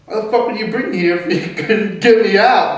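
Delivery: high, loud accusing burst with a broken laugh; retold angry outburst at mom. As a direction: hurl the question at your mother. Confronting mother in retelling.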